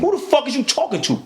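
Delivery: mild reenacted anger at a cop; retold angry challenge. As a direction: throw the question back at him, riled up. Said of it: "You raise your voice, I'm raising mine".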